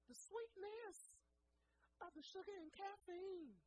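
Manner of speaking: high, slow, dreamy swoon. Retold or imitated. Guest swooning over rum and Coke, high and slow. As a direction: draw it out, lost in the taste. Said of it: "I'm getting a little emotional".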